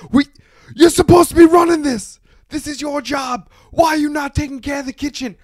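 high-pitched, exasperated berating; mock angry yelling. Owner to chef about the kitchen, retold angry yell. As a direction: imitate an owner losing it at staff.

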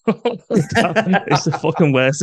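amused disgust, voice breaking into laughter; laughing scorn. Real, not acted. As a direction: laugh through the words. Laughing at his own mocking singing.

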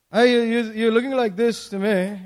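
usual speaking voice

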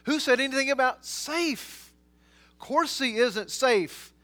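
high, loud, indignant disbelief; acted astonishment, strongly emphatic; incredulous. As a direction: scoff at the very question. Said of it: "He's incredulous".

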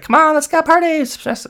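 very high, loud excited squeal; whooping party girl. Retold or imitated. Girl smacking him to get partying, high whoop. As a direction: mimic a girl shrieking to get the party going.

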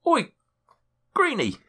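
cheeky gnome call. Acted. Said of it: "he turns around and he goes".

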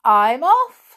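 loud, high, slow goblin farewell; jaunty hobgoblin. A narrator's reading. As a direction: toss it off cheekily. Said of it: "said the hobgoblin".

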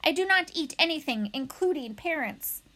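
lightly voiced matter-of-fact robot; normal robot voice. A narrator's reading. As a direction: state it plainly as a robot, starting high. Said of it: "returning to her normal voice".

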